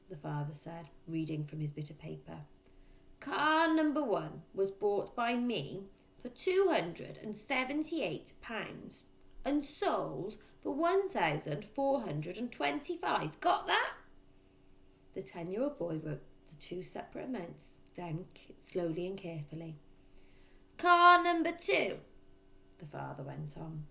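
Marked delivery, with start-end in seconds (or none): 3.2-13.9 dictating father. A narrator's reading. 20.8-22.0 slow, higher, louder dictation; dictating father. A narrator's reading. Father dictating car prices to his son, slow and loud.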